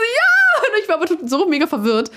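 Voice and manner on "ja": loud high forced yes; high mock shout of joy; forced cheer. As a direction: shout it up high to play along. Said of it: "Und ich so".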